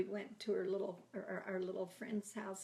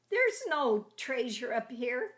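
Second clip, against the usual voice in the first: calling down; 5 semitones higher, 10 dB louder than usual. Retold or imitated. Calling down from the attic.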